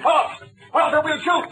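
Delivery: shouting a command at the fleeing man. Acted. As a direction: bark the order to stop. Ordering fleeing man to stop.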